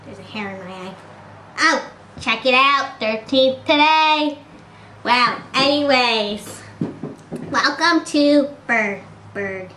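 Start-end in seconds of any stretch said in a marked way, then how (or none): none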